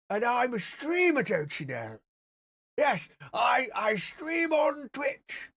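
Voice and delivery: hammed-up old codger greeting the crowd; old man voice. Streamer wearing an old filter greets viewers as a hammed-up old man. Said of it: "I could be old".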